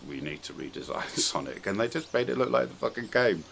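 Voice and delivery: ordinary delivery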